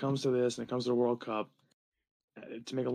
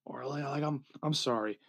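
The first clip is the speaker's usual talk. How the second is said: ordinary talk, nothing marked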